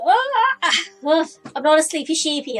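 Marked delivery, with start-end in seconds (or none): 0.0-1.3 loud open yawn sound. Real, not acted. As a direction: let a big yawn out. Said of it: "I'm yawning".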